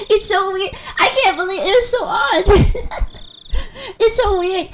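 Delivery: high-pitched giggling disbelief; laughing. Real, not acted. Streamer cracking up after imitating a YouTuber, helpless laughing. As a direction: barely get it out through laughter.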